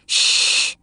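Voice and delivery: a soft unvoiced shh; shushing whisper. A narrator's reading. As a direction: hush them so she stays asleep. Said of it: "Dale said".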